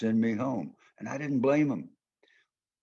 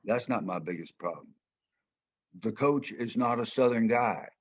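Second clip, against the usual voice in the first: normal talking voice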